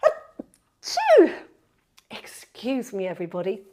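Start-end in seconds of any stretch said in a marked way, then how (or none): 0.0-1.3 loud, high sudden sneeze; real sneeze. Real, not acted. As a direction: let a real sneeze burst out. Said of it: "Oh, I'm gonna sneeze".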